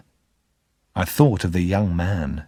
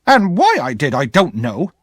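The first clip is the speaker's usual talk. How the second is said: exclaimed; 5 semitones higher, 3 dB louder than usual. Acted. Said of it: "my sister exclaimed".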